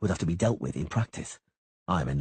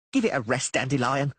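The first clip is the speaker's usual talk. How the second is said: snapping; 8 semitones higher, 4 dB louder than usual. A narrator's reading. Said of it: "Dainty snapped".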